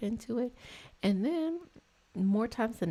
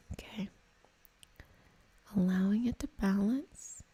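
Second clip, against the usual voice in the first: soothing guided-meditation voice; guided Reiki meditation; 4 semitones higher than usual. Acted. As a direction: ease them gently through the energy work.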